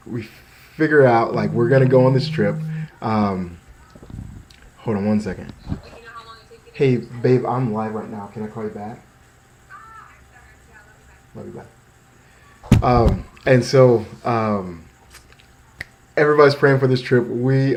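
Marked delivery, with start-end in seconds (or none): 4.8-12.9 quick hushed aside to someone on the phone; dodging a caller. Real, not acted. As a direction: softly put off a call while live. Aside to a caller while live.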